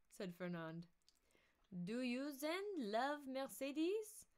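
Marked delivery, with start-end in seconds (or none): 1.8-4.2 higher, slow and teasing; slow, high sly voice. Acted. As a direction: draw out the question slyly. Voicing Danglars.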